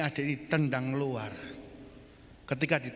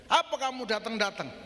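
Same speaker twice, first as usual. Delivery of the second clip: higher, confrontational retort; acted challenge; 6 semitones higher than usual. Prisoner to his visiting brother, challenging retort. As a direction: throw it out as a mock challenge. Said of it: "Tantang ya".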